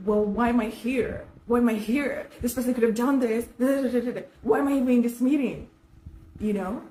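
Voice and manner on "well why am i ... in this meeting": louder, higher, rapid indignant snap; mock her own lashing out at superiors; condescending outburst. Woman in a meeting talking back to superiors, loud and condescending. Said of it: "Do you know what I said?".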